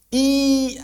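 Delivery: held practice i, pulled back hard; drawn-out i vowel. Shown as a demonstration. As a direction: stretch the sound I long and high. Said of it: "kalau masih latihan". (bunyi I ditarik panjang untuk latihan)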